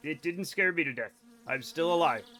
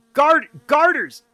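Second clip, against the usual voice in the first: loud, higher exasperated yell of the word; exasperated shout; 7 semitones higher, 10 dB louder, slower than usual. Real, not acted. Streamer yells the word at chat in exasperation.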